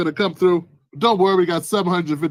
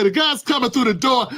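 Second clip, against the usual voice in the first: normal talking voice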